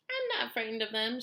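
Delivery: loud, high defiance; shouted. A narrator's reading. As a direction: shout it boldly. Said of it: "shouted the centipede".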